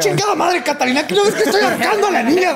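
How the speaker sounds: high, exasperated yell at the maid; hammed-up furious scolding; mock angry yell. Acted killer yelling at maid. (grito enojado exagerado)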